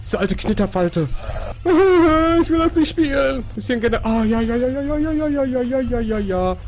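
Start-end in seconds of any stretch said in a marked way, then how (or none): 1.1-2.4 high, loud panicked laugh; nervous laugh. Real, not acted. 2.4-6.6 scared horror game streamer. Real, not acted. Frightened whining while fleeing.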